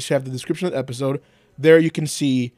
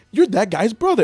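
lightly voiced accuser; 7 semitones higher, 4 dB louder than usual. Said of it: "how do you argue with".

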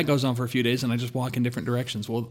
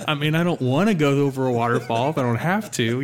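louder, half-laughing nervous objection; retold reluctance, pushed out louder; apprehensive protest; 3 semitones higher, 5 dB louder than usual. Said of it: "I was getting very apprehensive, very nervous".

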